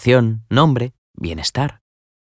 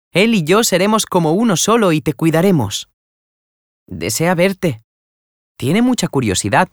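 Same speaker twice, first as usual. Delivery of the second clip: put-on higher, caring young woman fussing over a friend; worried young lady; 8 semitones higher than usual. A narrator's reading. Young woman fussing over her trembling friend, caring. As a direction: soothe and reassure, voice up high. Florentina worried, then happy.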